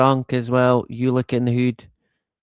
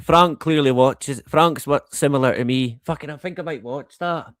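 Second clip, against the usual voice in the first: ordinary delivery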